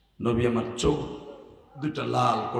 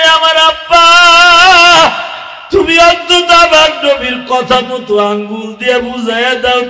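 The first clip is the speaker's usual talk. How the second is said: loud, high, chanted sermon storytelling; impassioned high-volume preaching chant; full-force preach; 12 semitones higher, 17 dB louder than usual. Real, not acted. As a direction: belt it out in a sing-song cry, holding the phrase endings.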